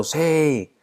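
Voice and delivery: a high, eager "Sí" cried out by the crowd; enthusiastic shout. Retold or imitated. Villagers shouting back at the landowner's question, high excited yes. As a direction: cheer it back like a whole village agreeing. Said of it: "el pueblo entero grita entusiasmado".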